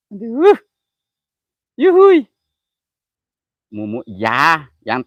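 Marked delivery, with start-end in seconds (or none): 0.1-2.3 much higher, louder yelp of glee; high gleeful yuhui; excited whoop. Real, not acted. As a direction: let out a sudden joyful whoop. Excited cry in spooky place.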